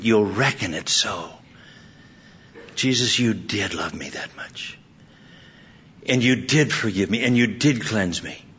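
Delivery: low, hushed, earnest prayer; heartfelt prayer. Acted. Acts out believer's prayer to Jesus.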